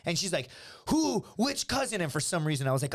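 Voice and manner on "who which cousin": high, sharp questioning; mock indignant interrogation; retold demanding girlfriend. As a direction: mimic a girlfriend demanding a name.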